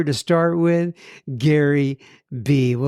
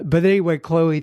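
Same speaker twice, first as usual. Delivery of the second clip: ordinary talk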